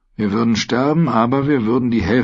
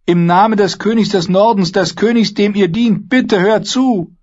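hoch, laut, zum Ende langsam gedehnt; flehend; 13 semitones higher, 5 dB louder than usual. Acted. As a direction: eindringlich um Gehör betteln. Brienne fleht weiter. (Brienne fleht im Namen des Königs, hoch und laut)

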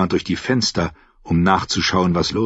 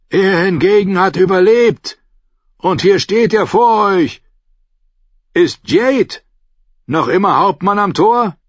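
higher and louder, hands cupped round the mouth; calling up; 11 semitones higher, 5 dB louder than usual. Acted. Davos calls up to the guard on the wall. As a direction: call up to the gate.

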